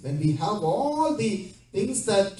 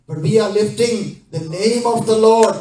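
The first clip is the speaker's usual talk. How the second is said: loud fervent preaching with rising urgency; preacher driving the point home to the congregation, loud; preaching at full force; 3 semitones higher, 5 dB louder than usual. Acted. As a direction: build the sermon to a loud climax. Preaching builds to a climax.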